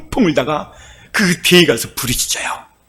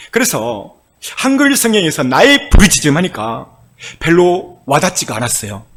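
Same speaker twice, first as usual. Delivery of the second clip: ordinary talk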